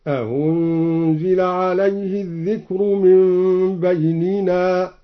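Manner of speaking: "أأنزل" as short a, then softened u, no long alif; slow chant, "أأنزل" eased into "a-unzila"; softened second hamza. Shown as a demonstration. Reciter showing one option, "أأنزل" softened without inserted alif. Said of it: "أو عدم الإدخال".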